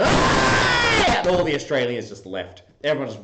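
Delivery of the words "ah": high, loud imitated crowd roar; long celebratory scream, imitating fans; cheering yell. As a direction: yell like fans when the winning goal goes in. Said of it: "all the Italians go".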